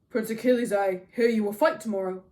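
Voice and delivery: loud, stately king's voice; Agamemnon, formal commander. A narrator's reading. Agamemnon asking Achilles about tomorrow's fight, loud and formal. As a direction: address him publicly, projecting.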